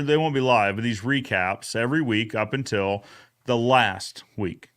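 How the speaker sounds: ordinary delivery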